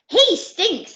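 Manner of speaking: loud, high howl of disgust. Acted. Pirate howls about the baby's smell, loud and high. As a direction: wail it out in horror. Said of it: "howled Khan".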